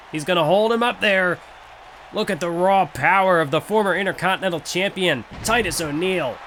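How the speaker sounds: rising play-by-play call; wrestling commentator. Acted. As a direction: call the match as it heats up. Live play-by-play of a match.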